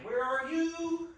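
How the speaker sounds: singsong call. Real, not acted. Searching for the socket, calling out.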